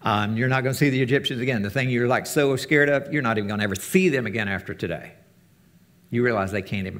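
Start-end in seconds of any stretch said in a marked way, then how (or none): none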